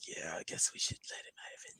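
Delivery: breathy retreating whisper. Retold or imitated. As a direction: back down in a hushed whisper, cowed. Retold merc backing off after arrow.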